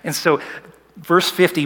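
usual speaking voice

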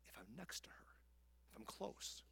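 unvoiced soft whisper; hushed aside. Shown as a demonstration. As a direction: whisper as if beside her. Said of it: "I can whisper".

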